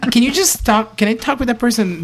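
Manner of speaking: high-pitched imitation of a woman caller; lady caller. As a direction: imitate her asking, voice lifted high. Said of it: "the lady was like".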